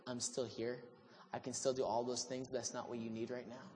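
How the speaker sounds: soft, low reassurance; quiet, gentle. Retold or imitated. God speaking softly to Elijah, quiet and reassuring. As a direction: gently tell him you're still here. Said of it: "He's like".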